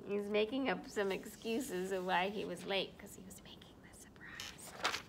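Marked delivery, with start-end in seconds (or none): 3.0-5.0 put-on secret whisper to the kids. Narrator letting kids in on the secret, whispering. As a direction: whisper it so Snowy won't hear. Secret told to child listeners.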